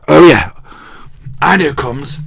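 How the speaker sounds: normal talking voice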